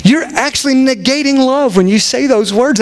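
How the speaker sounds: ordinary talk, nothing marked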